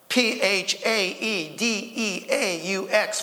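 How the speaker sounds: letter by letter, drawn out; slow spelling out. Acted. Third dog spells its fancy name to the others, slow spelling out. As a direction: spell it slowly as the joke's punchline. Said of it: "they're spelling out their name".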